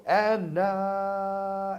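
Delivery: high, loud, long-held ring announcement; big arena announcer holler; announcer call. Shown as a demonstration. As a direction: belt it to trick the arena, holding the last vowel. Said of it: "I could trick 'em and go".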